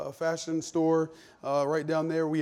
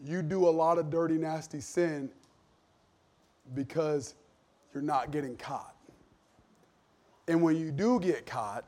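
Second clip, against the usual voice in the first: ordinary talk, nothing marked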